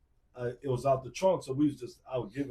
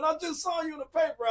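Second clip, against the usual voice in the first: very high, excited squeal; screaming excitedly; 4 dB louder than usual. Retold or imitated. Girl on the phone who saw him in the paper, very high and excited. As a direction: gush it out still squealing. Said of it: "she's screaming".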